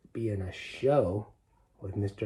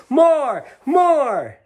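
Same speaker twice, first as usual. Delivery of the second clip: high, loud cries for more; crowd shouting; 11 dB louder than usual. A narrator's reading. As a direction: cheer like an eager crowd. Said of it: "a chorus of other voices shouting".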